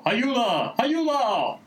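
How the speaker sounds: high-pitched, drawn-out shout; hammed-up distress call; acted cry for help. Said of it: "Pierce continúa gritando".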